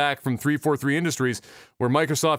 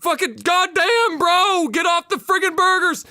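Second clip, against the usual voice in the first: high, strained griping; exasperated complaint; 13 semitones higher, 3 dB louder, slower than usual. Acted. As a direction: grumble at a too-heavy comrade. Joe complains while dragging him.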